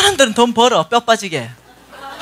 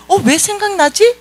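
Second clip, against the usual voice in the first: high, drawn-out questioning tone; puzzled wondering; 12 semitones higher, 3 dB louder, slower than usual. Shown as a demonstration. Preacher showing how to question a sudden thought, puzzled. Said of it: "질문해야 돼".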